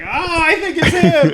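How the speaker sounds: high, slow, laughing squeak; mock high victim. Chainsawed victim blaming the one guy, in high laughing squeak.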